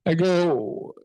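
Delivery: ordinary talk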